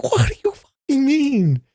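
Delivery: high, drawn-out exasperation; acted freak-out. Dalinar freaking out at the Stormfather, acted exasperation. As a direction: stretch it high in mock outrage. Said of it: "like, freaking out".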